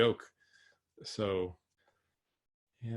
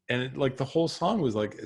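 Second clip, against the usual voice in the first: usual speaking voice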